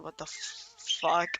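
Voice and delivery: low stunned whisper; hushed startled curse; whispered shock. Retold or imitated. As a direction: breathe it out in disbelief. Pascal startled by an alien.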